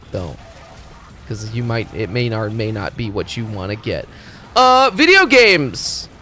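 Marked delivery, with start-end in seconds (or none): 4.5-6.1 higher and louder, slow; hammed-up enthusiasm; mock hype. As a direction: announce it like a cheesy game-show host. Mock hype about video games.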